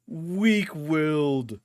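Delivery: drawn-out, menacing "Weak-willed."; slow sneering villain line; slow put-on villain voice. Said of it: "repeats it in a put-on voice".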